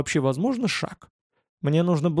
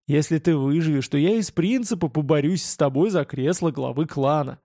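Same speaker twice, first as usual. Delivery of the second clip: ordinary delivery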